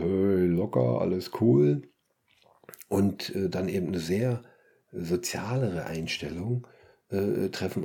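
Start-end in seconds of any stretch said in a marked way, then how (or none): 0.0-1.8 mock Berlin slacker. Caricature of laid-back Kreuzberg person.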